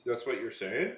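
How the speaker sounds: Canadian accent with the ends lifted; demonstrated Canadian upspeak. Shown as a demonstration. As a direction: lift "yeah" and "saying" like questions, Canadian style. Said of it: "they always accentuate at the end of almost everything".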